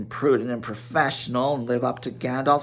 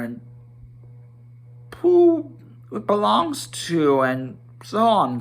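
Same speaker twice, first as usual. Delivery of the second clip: higher, tentative Bilbo voice, a little unsure; a small hobbit pushing his questions; nervously curious hobbit asking about the gold; timid hobbit; 4 semitones higher, 4 dB louder than usual. Acted.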